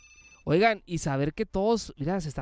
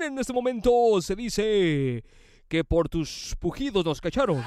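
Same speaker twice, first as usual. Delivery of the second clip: raised, lively radio announcer delivery; radio DJ announcing; 4 semitones higher than usual. Acted. Introduces songs and callers on air.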